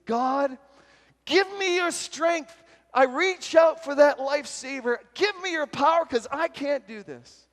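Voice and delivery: loud, high cry to God; acted shouted plea for strength; desperate prayer. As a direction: plead at full voice for rescue.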